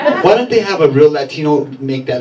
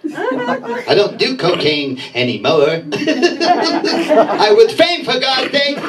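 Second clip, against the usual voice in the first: higher, excitable cartoon rabbit voice; Roger Rabbit impression; 5 semitones higher, slower than usual. Comic doing Roger Rabbit pleading in court, higher and frantic. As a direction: squeal and sputter like the cartoon rabbit. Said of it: "he'd be like".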